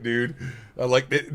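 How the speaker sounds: ordinary delivery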